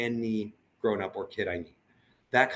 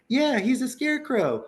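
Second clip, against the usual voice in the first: put-on high, slow child voice; imitating a kid's eager answer; kids answering; 6 dB louder than usual. Kids telling Michael what the puppet is, in a high child voice. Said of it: "They're like".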